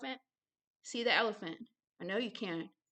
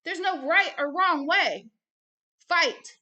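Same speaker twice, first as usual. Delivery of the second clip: loud, higher, slow and emphatic; rallying cry; 7 semitones higher, 9 dB louder, slower than usual. Real, not acted. Preacher rallying listeners to fight, loud and emphatic. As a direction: punch each word like a battle call.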